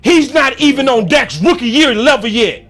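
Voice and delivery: high, loud fed-up rant; heated, forceful complaint; venting. Real, not acted. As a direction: hammer it home while venting. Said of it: "can I vent just for one second".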